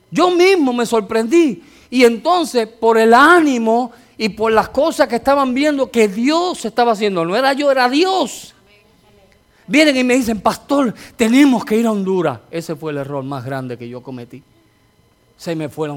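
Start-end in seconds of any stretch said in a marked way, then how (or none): 0.1-1.6 loud repeat. Shown as a demonstration. 1.9-12.4 loud, rising sermon climax; preaching at full force. Acted. Predica con fuerza.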